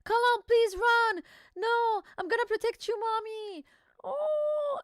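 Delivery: put-on high, slow, pleading little kid; a child begging and protecting mom. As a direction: kid crying out to protect mommy. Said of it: "kids read aloud".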